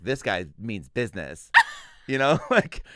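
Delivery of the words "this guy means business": slow, deliberate mock approval; mock impressed woman. Woman reacting to a babbling suitor, mock impressed. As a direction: draw the words out as if impressed. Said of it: "And the woman was like".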